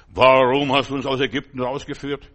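loud complaining question; Israelites' loud, indignant grumble; grumbling Israelites. Retold or imitated. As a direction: whine at God in protest.

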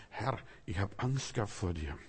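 a touch of low, soft fear; fearful confession. Acted. Adam admits his fear to God, softly. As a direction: confess it quietly, a little afraid.

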